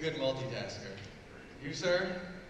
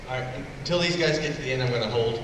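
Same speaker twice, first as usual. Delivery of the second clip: louder, projecting to the whole room; calling out to room; 6 dB louder than usual. Real, not acted. Host getting everybody ready to record, loud and upbeat.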